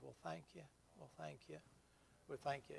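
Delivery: very soft, imitated weak gratitude; feeble thanks. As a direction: murmur thanks like a drained patient. Weak patient thanking him in retold story.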